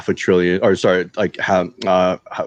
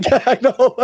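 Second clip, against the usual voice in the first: high, laughing through the words; can't keep a straight face; 3 dB louder than usual. Real, not acted. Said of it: "I can't say it with a straight face".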